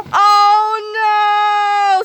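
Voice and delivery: loud, high terrified scream; a boy falling into an abyss, screaming. Acted. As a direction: scream it into the void. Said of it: "screamed one of the voices".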